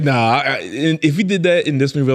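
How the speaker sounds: ordinary talk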